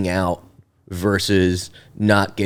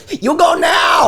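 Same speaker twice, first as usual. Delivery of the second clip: put-on broken English accent. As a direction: bark it out in a mock foreign voice. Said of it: "Or you just do like".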